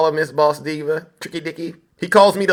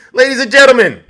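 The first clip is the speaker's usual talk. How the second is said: loud, high call to the crowd; announcer's call; 9 semitones higher, 9 dB louder, faster than usual. Real, not acted. Host hailing the audience before a clip, announcer's call. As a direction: belt it out like a ringmaster.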